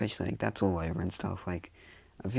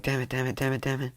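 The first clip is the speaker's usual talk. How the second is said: higher, repeated muttering in a rush; lightly acted alarm, pitched up; mild panic; 6 semitones higher than usual. Students running over to the falling boy, mildly panicked.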